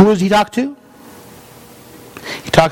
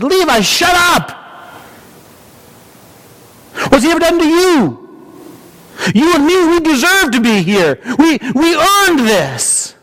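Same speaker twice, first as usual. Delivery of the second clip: sharp, much higher, raised rebuke; angry rebuke; 10 semitones higher than usual. Acted. Thief rebukes fellow criminal Levi, angry raised voice. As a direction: snap at him to shut up, indignant.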